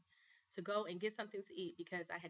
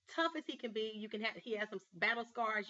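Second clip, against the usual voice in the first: usual speaking voice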